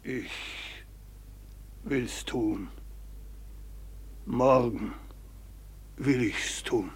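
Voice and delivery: whispered, defeated consent; broken whisper. Acted. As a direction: barely get the words out. Alter Diener gibt gebrochen nach. (gebrochen geflüstert)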